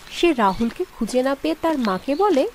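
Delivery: usual speaking voice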